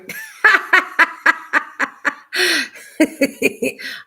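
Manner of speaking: loud, high laughing fit; high burst of laughter. Real, not acted. Laughing loudly as the dog reacts on camera.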